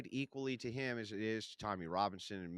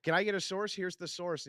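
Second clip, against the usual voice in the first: higher, louder mock demand; mocking whine; 7 semitones higher, 4 dB louder than usual. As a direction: sneer while parroting him. Mocking the request for sources.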